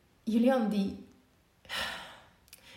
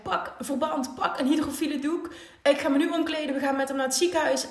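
quick, louder, higher urgent instructions; urgent orders; 6 semitones higher, 5 dB louder than usual. Retold or imitated. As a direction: bark out orders in a panic. Friend rushes in with injured child. (dringende bevelen, luider en hoger)

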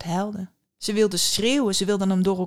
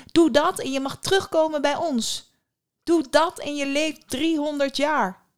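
high, urgent, louder sister voice; eldest sister calling; 7 semitones higher, 3 dB louder than usual. A narrator's reading. Eldest sister offering the dagger to save her, calling urgently. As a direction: urge her on, pitched well up. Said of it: "riep de oudste".

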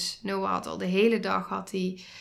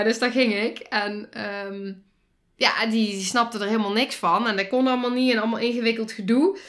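normal talking voice

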